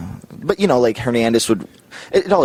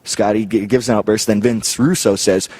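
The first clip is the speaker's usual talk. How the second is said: ordinary talk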